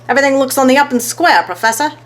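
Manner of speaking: gruff gambler. A narrator's reading. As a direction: rattle it off like a bookie.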